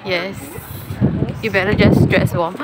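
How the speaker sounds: ordinary delivery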